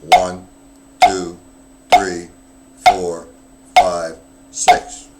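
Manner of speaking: loud, punched count over the drumming. Shown as a demonstration. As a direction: call each count like a drill instructor. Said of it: "the double paradiddle's sticky".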